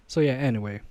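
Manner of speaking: usual speaking voice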